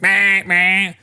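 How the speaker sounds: high mock buzz of the scooter alarm; droning noise. Man imitating the old man's scooter alarm, high droning. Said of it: "it's like".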